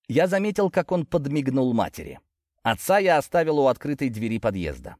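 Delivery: plain reading voice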